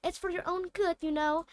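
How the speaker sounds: high, sugary mock-pious tone; sanctimonious ghost, high and sweet; saintly voice. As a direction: fake holiness, poltergeist teasing. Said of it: "in a saintly voice".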